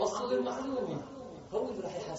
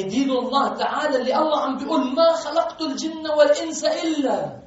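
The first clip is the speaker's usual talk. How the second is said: loud rising sermon voice; preaching at full force; 7 semitones higher, 11 dB louder than usual. Real, not acted. Preacher lifts his voice to the congregation, preaching loudly. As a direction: preach it out to the hall.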